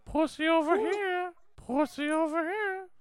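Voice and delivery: very high calling pussy over here; mock mating call. Riffing on mating calls, high peacock squawk. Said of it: "Like, uh".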